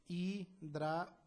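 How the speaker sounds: Hidratación split slowly into hi-dra-ta-ción; syllable by syllable. Shown as a demonstration. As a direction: break Hidratación into its syllables, pausing between them. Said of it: "descomponer en sílabas".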